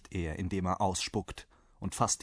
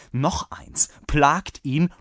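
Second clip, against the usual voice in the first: raised, incredulous echo; exasperated host; 6 semitones higher, 6 dB louder, slower than usual. A narrator's reading. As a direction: repeat the order in disbelief, rising louder. Host incredulously repeats the order. (fassungsloser, genervter Wirt)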